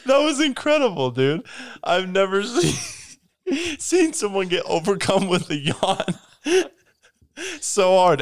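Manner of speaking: high laughing disbelief. Real, not acted. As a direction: squeal through giggles. Laughing at friend's yawn.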